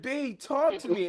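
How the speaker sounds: high-pitched imitation of pleading; mock a crying friend begging; pleading, lightly acted; retold tearful plea. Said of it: "they're crying".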